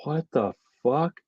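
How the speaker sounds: hushed disbelief, like a shocked crowd member; imitated stunned disbelief. Fan retelling the crowd's shock at a quick defeat, stunned. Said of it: "people were like".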